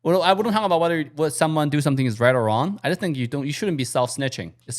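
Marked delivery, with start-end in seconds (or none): none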